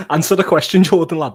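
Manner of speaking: loud, high, amused demand; laughing demand. Real, not acted. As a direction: press him loudly through laughter. Teasing demand through laughter.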